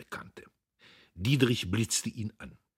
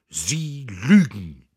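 slow, loud outcry; the old man flares up at him; slow, loud outraged retort; furious accusation; 2 semitones higher, 13 dB louder, much slower than usual. A narrator's reading. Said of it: "rief der alte Buck".

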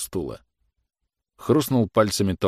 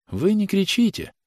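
high-pitched pleading protest; distressed mother; 13 semitones higher than usual. A narrator's reading. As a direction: plead with the officer in a raised pitch. Mother, trembling, pleads with the officer.

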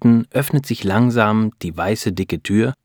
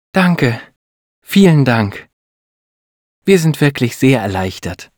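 higher, louder relieved thanks; delighted; 5 semitones higher, 5 dB louder than usual. A narrator's reading. Grateful wife to doctor after good news, delighted. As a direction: thank her with joyful relief. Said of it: "sagt Beate erfreut".

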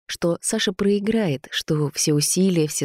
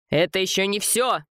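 angry roar; 3 semitones higher than usual. A narrator's reading. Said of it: "ревел Вова, да так громко".

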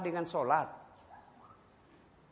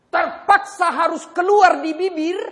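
much louder and higher, preaching hard; loud emphatic; 9 semitones higher, 13 dB louder than usual. Acted. Preacher warning couples about angry words, loud preaching. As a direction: punch this line out. Sermon climax.

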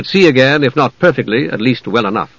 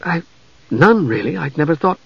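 higher, stammering confusion; bewildered; 3 semitones higher than usual. A narrator's reading. Young mage answers the knight, bewildered and halting. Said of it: "Palin was bewildered".

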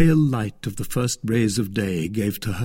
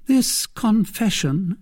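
apprehensive; 10 semitones higher, slower than usual. A narrator's reading. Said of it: "growing paler".

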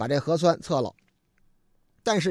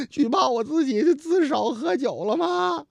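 mock crying; 7 semitones higher than usual. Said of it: "哭的呀".

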